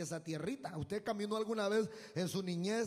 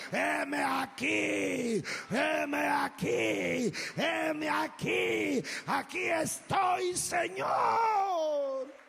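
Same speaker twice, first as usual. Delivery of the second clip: slow, rising shouted surrender, much higher; fervent cry; 5 semitones higher, 6 dB louder, much slower than usual. Shown as a demonstration. Preacher showing the church how to answer God, fervent shout. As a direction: cry it out slowly as an offering to God. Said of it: "que le digamos".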